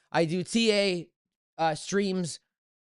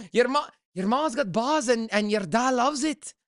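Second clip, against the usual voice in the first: mock Scottish accent; 5 semitones higher than usual. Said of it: "they roll the R's".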